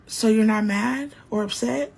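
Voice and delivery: ordinary delivery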